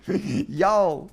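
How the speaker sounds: a loud, high, hammed-up Southern American drawl on y'all; mock American y'all. Teasing a super American viewer in mock Southern American drawl. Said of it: "super, super American".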